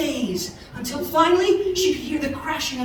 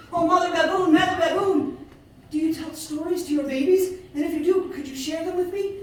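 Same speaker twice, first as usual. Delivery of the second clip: calling baboon; 7 semitones higher, 4 dB louder than usual. A narrator's reading. Sifa calls to baboon.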